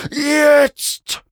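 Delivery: high, loud, long-held scream. A narrator's reading. Mount screams a third time while racing down, straining scream. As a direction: hold it out as a high battle cry. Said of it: "schrie Mac zum dritten Mal".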